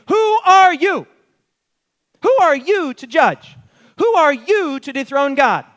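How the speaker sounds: preacher's ringing rebuke; thundering question. Acted. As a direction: challenge them from the pulpit.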